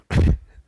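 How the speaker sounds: short breathy laugh burst; laughing through pain. Real, not acted. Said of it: "it hurts to laugh".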